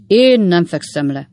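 put-on loud, emphatic drunken refusal; firm refusal. Drunk Winkle refusing to go to bed, loud and firm. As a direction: plant your feet and declare it loudly. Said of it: "mondta Winkle úr erélyesen".

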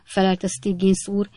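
ordinary delivery, nothing marked

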